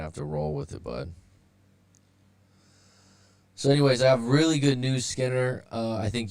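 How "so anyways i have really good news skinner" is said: fast, upbeat announcement; excited. Real, not acted. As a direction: burst out with the good news. Announcing good news to viewer.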